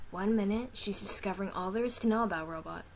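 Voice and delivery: plain reading voice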